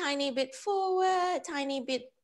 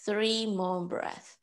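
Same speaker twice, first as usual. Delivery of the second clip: slow, measured meditation voice with long pauses; guided breathing; 7 semitones lower than usual. Real, not acted. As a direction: count them calmly through each breath, unhurried. Leads breath counting and meditation.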